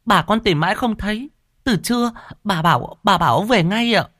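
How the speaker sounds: put-on high, anxious young servant girl; maid Cúc's voice. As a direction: urge the master home, pitched high like a girl. Cúc reports to her master. (giọng cô hầu gái lo lắng, cao)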